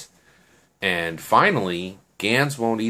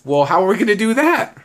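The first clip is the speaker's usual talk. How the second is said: high, loud retold objection; incredulous question; 13 semitones higher, 7 dB louder than usual. Girl questioning his plan to get the phone, incredulous. Acted alarmed question to the man.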